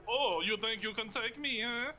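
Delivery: put-on Canadian accent, goad him like a cocky wrestler; wrestler's Canadian accent. Potato wrestler Tater Von Spud taunts the player, Canadian accent. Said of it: "That's a good accent".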